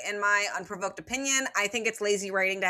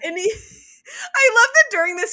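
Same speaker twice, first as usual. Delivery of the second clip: laughing; 12 semitones higher, 8 dB louder, faster than usual. Real, not acted. Laughing while telling.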